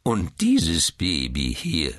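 slow, emphatic querulous retort; defiant old man. A narrator's reading. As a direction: draw it out in cranky defiance. (trotzig quengelnder Alter)

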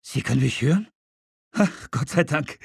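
hörbar erleichtertes Aufatmen. Acted. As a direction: atme auf, endlich hört dich jemand. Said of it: "und dann erleichtert". (erleichtert aufatmend)

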